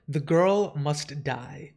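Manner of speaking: drawn-out, solemn, each word weighed; slow grave verdict. Acted. Dream slowly and gravely pronounces the girl's death. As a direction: lay the sentence down slowly like a final judgment.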